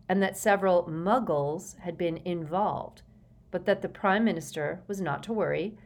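ordinary delivery, nothing marked

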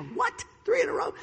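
high-pitched astonished reaction; incredulous, voice leaping up; acted disbelief. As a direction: react stunned to yet another crash. Reacting to the third crash.

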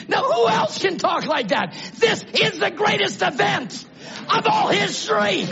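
shouted, high exultant preaching; preaching at full force. Real, not acted. Preacher rallying his congregation, shouted high exultation. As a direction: lift the congregation to shout praise.

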